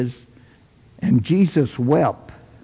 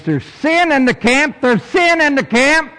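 high, insistent pulpit cry; preached proclamation; 11 semitones higher than usual. Acted. The Lord warning Joshua of sin, as a preached proclamation. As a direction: proclaim it, pitched high both times.

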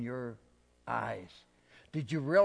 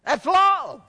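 shout; 14 dB louder than usual. Acted. Shouted climax of sermon.

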